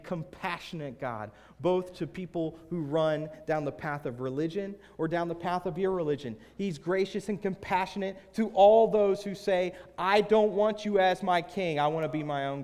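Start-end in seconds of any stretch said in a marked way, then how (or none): 1.6-12.6 loud, high sermon build, easing at the end; forceful preaching. Real, not acted. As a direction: proclaim it to the whole congregation.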